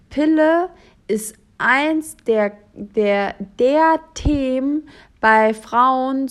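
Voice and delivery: slow, emphatic lecturing; slow emphatic quote. Retold or imitated. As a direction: drag it out for emphasis. Quoting follower emphatically.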